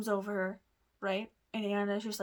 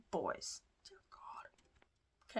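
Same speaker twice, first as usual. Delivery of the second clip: hushed aside; 7 dB quieter, much slower than usual. Real, not acted. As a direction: drop to a whisper, half to yourself. Aside about the boys' table.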